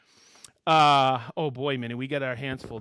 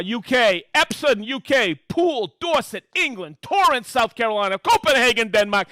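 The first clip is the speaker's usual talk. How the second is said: upbeat, louder roll-call of hometowns; excited shout-outs; 6 semitones higher, 6 dB louder than usual. Acted. Host reading viewer hometowns to the live audience, excited and loud. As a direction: call each town out like a game-show host.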